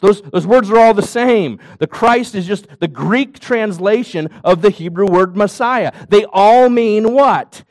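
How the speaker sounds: usual speaking voice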